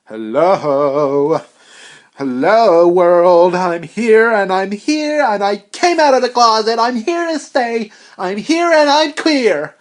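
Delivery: shouted high, triumphant cry; mock proclamation. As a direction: proclaim it to the whole world.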